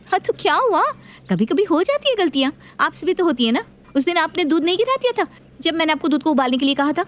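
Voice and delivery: daughter-in-law. Acted. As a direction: throw it back at her.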